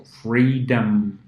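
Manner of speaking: "freedom" said slowly, ending in dem; slow demo word. Shown as a demonstration. Teacher models the word freedom slowly. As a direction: stretch out "freedom" for learners. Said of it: "here we say".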